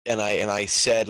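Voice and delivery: ordinary talk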